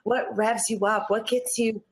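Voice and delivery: ordinary delivery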